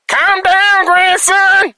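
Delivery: calm down in a squeaky high comic voice; goofy high voice. Pretend. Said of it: "bring in humor whenever I get a little emotional".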